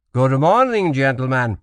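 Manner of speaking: put-on Portuguese-accented formal greeting; strong foreign accent. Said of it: "said the governor in a strong accent".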